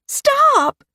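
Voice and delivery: put-on very high, loud child's scream; little girl screaming. A narrator's reading. Girl on the swing screaming at Mary to stop. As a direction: scream it like a frightened small girl. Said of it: "screamed the girl".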